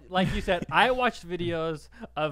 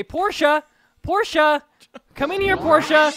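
much higher, loud calling out; Ellen impression; 11 semitones higher, 9 dB louder than usual. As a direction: Ellen calling Portia from the kitchen. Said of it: "and I say".

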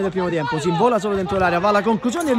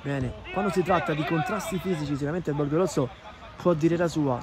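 usual speaking voice

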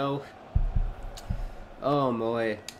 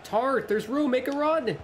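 high urgent call to a teammate; urging the runner forward, voice jumping up; excited calling out; 10 semitones higher than usual. Real, not acted. Gamer yelling at his player to make the run, much higher.

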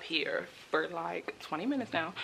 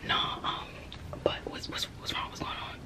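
hushed whisper on a call; whispering on the phone; 4 dB quieter than usual. Real, not acted. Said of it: "Why is you whispering?".

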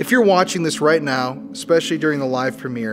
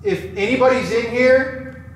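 higher, slow summoning call; calling out to spirits; 10 semitones higher, slower than usual. Acted. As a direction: address the dark room solemnly.

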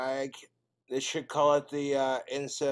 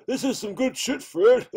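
Barney voice; 9 semitones higher, 8 dB louder than usual. Acted.

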